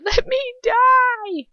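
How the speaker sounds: long, high, agonised scream. A narrator's reading. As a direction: scream it out, begging for release. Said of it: "I feel myself scream out those words".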